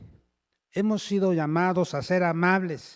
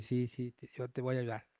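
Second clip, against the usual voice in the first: much softer, humble yes-yes; meek agreement; 5 semitones lower, 11 dB quieter than usual. Shown as a demonstration. Husband calmly agreeing with his upset wife, very soft. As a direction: give in softly and gently.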